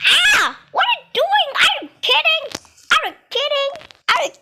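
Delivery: very high, loud are you kidding; shouting at a troll who pushed someone; outraged shouting. Real, not acted. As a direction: shriek in disbelief at the troll.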